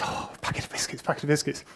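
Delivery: mock mutter as if chewing biscuits; mumbling with mouth full. Speaker imitating Stallman receiving biscuits from the crowd, mock mutter. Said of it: "So he goes".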